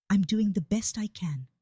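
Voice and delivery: plain reading voice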